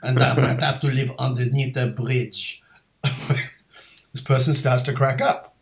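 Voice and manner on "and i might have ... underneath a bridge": put-on stage Frenchman's accent; deliberately hammy French accent. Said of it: "doing a French accent".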